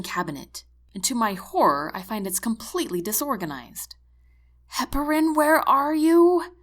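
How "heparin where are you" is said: loud, higher, exasperated call; frantic searching. A narrator's reading. Medic searches a disorganized cabinet for heparin, frantic. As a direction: call out desperately for the missing drug.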